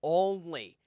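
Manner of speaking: heavy stress on "Only"; stressed word. Real, not acted. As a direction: land hard on "Only" as a warning. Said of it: "and I stress the word only".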